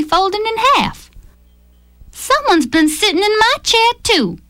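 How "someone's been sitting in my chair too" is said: higher, complaining mother bear voice; grousing Mama Bear. A narrator's reading. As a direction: gripe it like a put-out mama bear. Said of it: "she groused".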